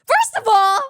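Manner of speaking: tearful, high and loud admission; owning up through tears; admitting to crying. Real, not acted. Streamer answering chat that he is crying, tearful and loud. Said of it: "Cry pussy".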